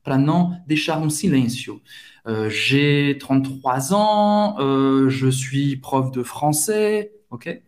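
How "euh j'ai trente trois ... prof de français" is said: slow, reflective French with drawn-out "euh" fillers; pensativo, hesitante. Shown as a demonstration. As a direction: think aloud, stretching each "euh". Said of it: "quando você tá refletindo". (reflexivo, arrastando o "euh")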